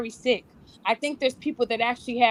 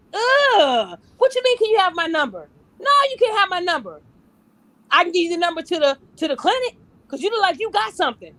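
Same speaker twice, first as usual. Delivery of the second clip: much higher, louder mock sass; imitating a woman's loud insulting refusal; rude brush-off; 5 semitones higher, 4 dB louder than usual. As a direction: sneer it like a woman turning down a man.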